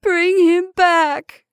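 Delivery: high, slow, commanding wail; desperate, ringing command; loud cry. A narrator's reading. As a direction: cry out, stretching each word. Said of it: "cried the old woman".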